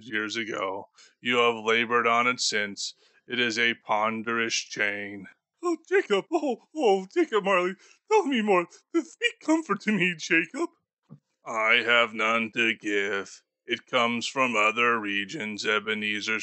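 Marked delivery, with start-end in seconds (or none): 5.6-10.7 put-on higher, frail old miser begging for comfort; a frightened old man pleading with his dead partner; pleading Scrooge. Scrooge begs Marley.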